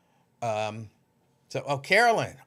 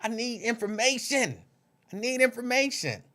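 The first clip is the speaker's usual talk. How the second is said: playful demand; 7 semitones higher than usual. Real, not acted. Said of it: "y'all not telling me where y'all from".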